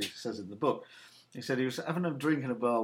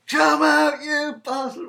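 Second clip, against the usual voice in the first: hammed-up bellow, much higher and louder; mock angry shout; 13 semitones higher, 9 dB louder, much slower than usual. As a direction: yell at the door like a pub brawler. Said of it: "Whatever they say".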